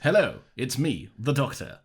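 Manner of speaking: put-on Doctor greeting in transatlantic accent. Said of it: "another man with a transatlantic accent".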